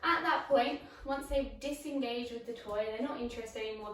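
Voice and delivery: ordinary talk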